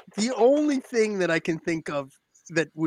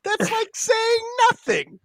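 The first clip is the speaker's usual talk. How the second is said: high, drawn-out outburst; exasperated shout; 3 dB louder than usual. Real, not acted. Co-host ranting at vague forecasts, exasperated. Rant about fifty percent chance.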